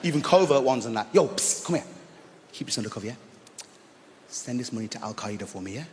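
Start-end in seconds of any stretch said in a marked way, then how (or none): 1.1-5.8 low, soft secret voice; conspiratorial whisper-ish. Pretend. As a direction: keep it hush-hush. Secretive request.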